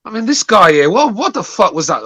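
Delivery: fired up and indignant; angry ranting. Real, not acted. Angry rant at caller.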